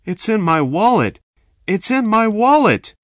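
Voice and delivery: high, loud panicked blurt; frightened. A narrator's reading. Victim answering robber at gunpoint, high panicked cry. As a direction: blurt it out in fear, rising.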